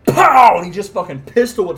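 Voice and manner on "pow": loud high comic-book pow; big sound-effect yell for a punch; shouted pow. Acted. Pistol whip sound effect.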